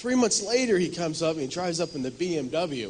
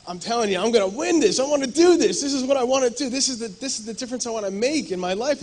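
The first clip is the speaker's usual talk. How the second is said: determined; 8 semitones higher, 6 dB louder than usual. Retold or imitated. Retold determined vow.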